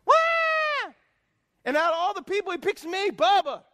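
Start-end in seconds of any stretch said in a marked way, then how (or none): 0.1-0.9 high loud demon shout; demon shriek. Retold or imitated. Demon interrupting the preacher, shrieking loud. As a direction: screech like a possessed man. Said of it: "he goes".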